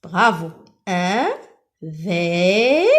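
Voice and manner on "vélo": "vélo" split into a long vé, then lo; sounded-out syllables. Shown as a demonstration. Teacher helping pupils fill letters, "vélo" slowly sounded out. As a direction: stretch both syllables of "vélo" with a gap. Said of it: "il y a seulement V et L".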